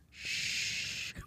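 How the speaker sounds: soft unvoiced shush. Shown as a demonstration. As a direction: hush her with a sharp shh. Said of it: "That would be me".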